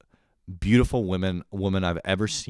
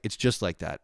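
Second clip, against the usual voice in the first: ordinary talk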